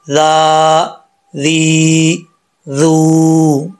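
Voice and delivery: very slow, each syllable drawn out; slow recitation drill. Shown as a demonstration. Teacher drilling vowel marks with students, slow recitation. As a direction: spell it out slowly for the class. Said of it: "अब हरकत पढ़ते हैं".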